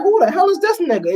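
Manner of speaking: high, puzzled mock stranger voice; lightly mocking a confused follower. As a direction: play the baffled stranger reading your message. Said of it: "They be looking at me like".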